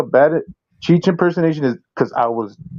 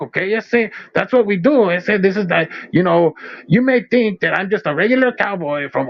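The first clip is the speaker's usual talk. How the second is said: crank the pitch up and camp up the Chicano slang like Cheech; bad Cheech impersonation; 5 semitones higher than usual. Cowboy bragging to the homies about his cows in a bad Chicano accent. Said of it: "Sounds like a bad Cheech impersonation".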